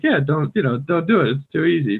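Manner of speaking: lightly acted brush-off; acted native speaker. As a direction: toss it off as easy advice. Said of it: "as a native speaker".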